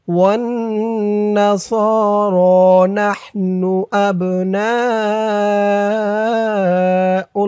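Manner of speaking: slow measured tajweed chant; Quran recitation. Shown as a demonstration. Teacher reciting the verse for learners, slow chant.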